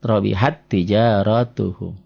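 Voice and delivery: slow recited "robihat tijaratuhum", holding the doubled t; merged double t. Shown as a demonstration. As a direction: join "robihat tijaratuhum" on one held t, long vowels after. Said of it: "huruf matinya jangan dibaca".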